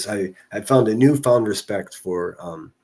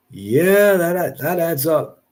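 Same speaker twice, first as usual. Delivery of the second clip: sarcastic; 8 semitones higher, 6 dB louder, much slower than usual. Real, not acted. Sarcastic reaction to chat claim.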